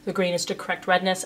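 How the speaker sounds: normal talking voice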